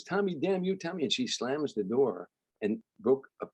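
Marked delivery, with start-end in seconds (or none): 0.0-1.0 retold angry yell. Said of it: "Meanwhile, she's mad".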